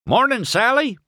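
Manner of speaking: high, bright call across the road. A narrator's reading. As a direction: hail her from afar. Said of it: "Mater called".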